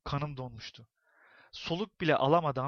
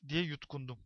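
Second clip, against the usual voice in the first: ordinary delivery, nothing marked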